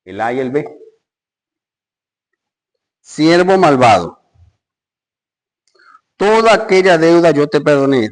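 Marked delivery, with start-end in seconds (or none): 3.0-8.1 angry king. Acted. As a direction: thunder it out as the betrayed master. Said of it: "su señor enojado".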